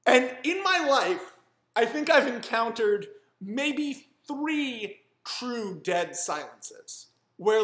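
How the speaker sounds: slow, weighted storytelling; dramatic slow. Real, not acted. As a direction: draw out the dead silence. Dramatic recollection of the silence.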